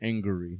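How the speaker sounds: "Angry" said as ANG-guh-ree, with an added middle syllable; three-syllable angry. Shown as a demonstration. Insisting on the feeling to the co-host, "Angry" as ang-guh-ree. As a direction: stretch "Angry" into ang-ger-ee. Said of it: "Not even angry".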